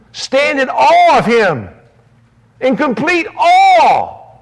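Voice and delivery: slow, loud pulpit cry; emphatic exhortation. Acted. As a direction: proclaim each word with awe. Preacher exhorts after scripture.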